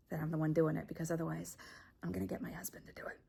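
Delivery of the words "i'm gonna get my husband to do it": fast threatening whisper; menacing whisper. Acted. Threatening the man who hit on her, in a fast menacing whisper. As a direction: rush the threat out in a voiceless hush.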